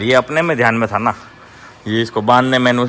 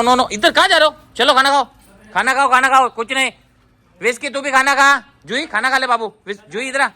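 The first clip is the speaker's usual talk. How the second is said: ordering the dogs; 10 semitones higher than usual. Real, not acted. Ordering dogs after fight.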